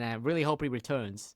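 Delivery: ordinary talk, nothing marked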